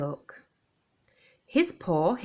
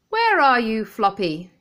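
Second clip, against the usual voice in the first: louder call across the house; 3 semitones higher, 6 dB louder than usual. Acted. Chip comes home and calls for his dog, calling out. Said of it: "he called".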